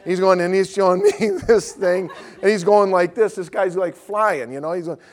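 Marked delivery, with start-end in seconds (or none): none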